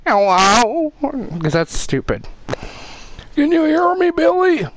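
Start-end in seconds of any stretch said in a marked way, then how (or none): 0.1-0.9 mock ghost hunter hello. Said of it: "I'm not gonna be like". 3.4-4.7 high mock ghost hunter call. Mocking ghost hunter calling spirits.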